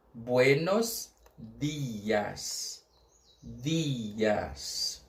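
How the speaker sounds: buenos dias very slowly, then dias alone; slow repeat-after-me. Shown as a demonstration. Teacher asks the class to repeat buenos dias slowly. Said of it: "Vamos repetir comigo?".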